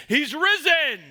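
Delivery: joyful proclamation. Acted. Pastor proclaiming Easter to the congregation, loud and high.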